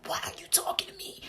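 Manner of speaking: soft, whispered rasp; raspy. Retold or imitated. To the nurse after extubation, a soft raspy whisper. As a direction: croak it with a voice unused for weeks. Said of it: "All raspy 'cause I haven't spoken in weeks".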